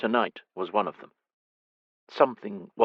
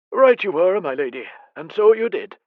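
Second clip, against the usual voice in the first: praising; 10 semitones higher, 6 dB louder than usual. Acted. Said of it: "praised her".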